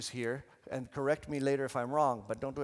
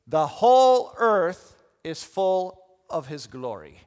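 loud, slow modelled response; proclaiming; 12 dB louder, slower than usual. Shown as a demonstration. Preacher showing one side its line, proclaiming. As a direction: declare it slowly for them to repeat.

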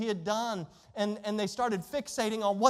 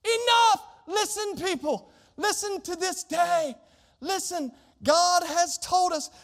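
much higher, loud shout to silence a crowd; commanding shout; 8 semitones higher, 4 dB louder, slower than usual. Retold or imitated. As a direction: Caleb bellows to still the people. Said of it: "I imagine it like this".

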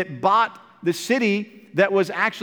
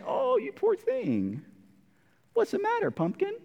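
cooing wife; 12 semitones higher, 7 dB quieter than usual. Retold or imitated. Jezebel consoling her sulking husband, high soft coo. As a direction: coo over him like a pouting child. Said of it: "And Jezebel comes in and says".

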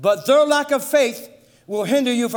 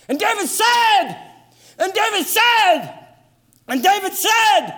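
preaching build-up; 8 semitones higher than usual. Acted. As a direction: build each repeat to a shout.

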